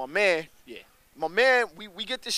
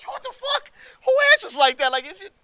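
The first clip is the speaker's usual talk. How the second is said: high, disbelieving outburst; can't believe that answer, squeaky high; incredulous; 8 dB louder than usual. Retold or imitated. Rider reacting to the stranger's answer, high incredulous outburst.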